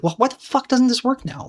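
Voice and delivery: sudden high-pitched exasperated cry; frustrated outburst. Retold or imitated. Trader reliving his rage at a pattern that stopped working, exasperated high outburst. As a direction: blurt it out, fed up with a broken system. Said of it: "I would just get frustrated and be like".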